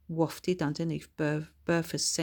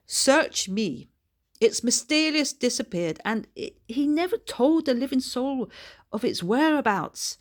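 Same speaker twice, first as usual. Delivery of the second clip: put-on high, brisk old Scottish lady; chatty Scots auntie, pitched well up; higher, louder old lady puzzling aloud; Bertha's voice; 7 semitones higher, 5 dB louder than usual.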